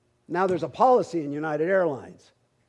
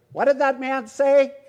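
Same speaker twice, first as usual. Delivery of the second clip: high, loud imitation of a wife; mock phone squawk, demanding to know; wife on speakerphone; 7 semitones higher, 8 dB louder than usual. Wife asking her husband over speakerphone, high mock yell. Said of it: "his wife on the phone. I hear her".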